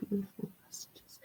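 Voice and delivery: barely audible whisper; very soft private aside; whispered to self. Real, not acted. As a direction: breathe it out almost silently. Murmuring to herself while reading cards.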